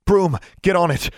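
high, urgent order; commanding. A narrator's reading. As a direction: command it sharply in the heat of a fight. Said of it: "she commanded".

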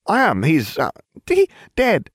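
very high, stumbling over the lie; flustered ditzy witch. A narrator's reading. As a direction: fumble the fib in a squeaky voice. Farfelu fumbling a lie.

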